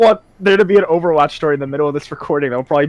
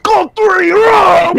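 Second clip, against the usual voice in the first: much higher, louder yell; 13 semitones higher, 6 dB louder, much slower than usual. Retold or imitated. Teammate yelling at the speaker, high loud yell. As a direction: bark the verdict like a quiz host. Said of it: "he yelled".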